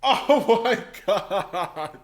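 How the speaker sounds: laughing. Real, not acted. Laughing reaction to clip.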